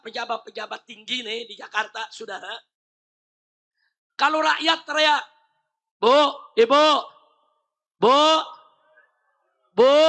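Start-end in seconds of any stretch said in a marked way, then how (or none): 6.0-10.0 calling out. Real, not acted. Calls out to women in audience.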